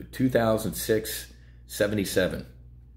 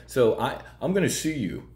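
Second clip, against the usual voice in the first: mocking Cuomo; 5 semitones higher than usual. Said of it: "That's Governor Cuomo".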